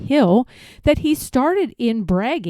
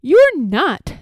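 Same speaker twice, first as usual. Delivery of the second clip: a sharp, higher, louder retort; snapped; 6 semitones higher, 5 dB louder than usual. Acted. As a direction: snap back at him, offended. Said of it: "snapped the rabbit".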